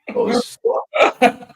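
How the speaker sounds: ordinary talk, nothing marked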